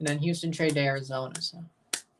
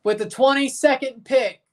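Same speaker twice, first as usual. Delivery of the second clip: higher, louder draft-podium announcement; punchy, raised draft announcer voice; Goodell announcing; 5 semitones higher, 3 dB louder than usual. Acted. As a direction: announce the pick like the league commissioner. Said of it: "Here comes Goodell to give the Eagles pick".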